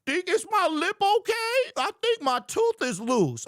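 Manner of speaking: milk the injury for sympathy; overacted victim checking his teeth; hammed-up injured fan. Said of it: "I would have been like".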